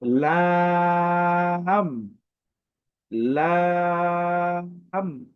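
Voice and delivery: লাম with a thin aa, held very long; thin-sounding letter. Shown as a demonstration. Teacher models the letter for learners, thin, drawn-out লাম. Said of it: "তাই automatic এটি পাতলা".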